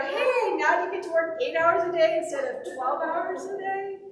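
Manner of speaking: loud, high-pitched sisterly ribbing; teasing. Retold or imitated. Sister teasing her brother about his demotion, playful ribbing. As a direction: rib him brightly, lifting the voice high. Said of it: "So I was teasing my brother".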